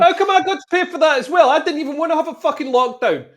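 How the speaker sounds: real exasperation, pushing back hard; indignant outburst. Real, not acted. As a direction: friend fuming at having to pay for lockdown, indignant. Indignant rant about paying for lockdown.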